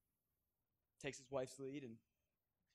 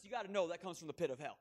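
ordinary talk, nothing marked

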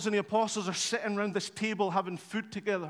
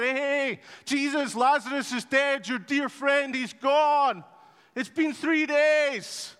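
urgent news; 5 semitones higher, 5 dB louder, slower than usual. Acted. Messenger brings news Lazarus died.

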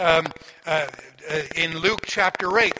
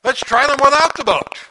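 higher, louder friendly challenge; Jesus coaxing Peter onward, raised and louder; Jesus inviting; 4 semitones higher, 5 dB louder than usual. Retold or imitated. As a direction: call Peter out of the boat, bright and loud. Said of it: "the Lord says, in effect".

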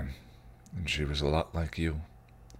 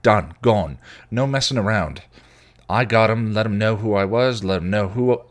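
louder boasting rant, hammered out; 4 semitones higher, 7 dB louder than usual. Acted. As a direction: punch each short word like a blow. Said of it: "his rant".

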